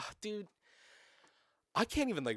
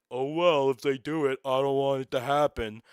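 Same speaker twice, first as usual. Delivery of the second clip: louder put-on voice of a spiteful partisan; hammed-up mock outrage, loud and silly; mock hater voice; 3 semitones higher, 7 dB louder than usual. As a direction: ham up an absurd, petty straw man. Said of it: "To be like".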